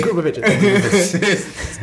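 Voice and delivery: mock fast mimicry. Co-host teasing friend who said that so fast, mock mimicry. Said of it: "the way that you said that so fast".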